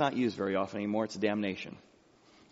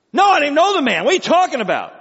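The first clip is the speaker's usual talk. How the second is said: high, loud, indignant outburst; shouted denial; 13 semitones higher, 11 dB louder, faster than usual. Acted. Peter's panicked denial, acted loudly.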